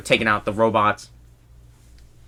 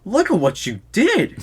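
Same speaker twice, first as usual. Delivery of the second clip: high, slow accusing scold; blaming scold; 8 semitones higher than usual. Retold or imitated. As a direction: pin the blame on them. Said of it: "He's like".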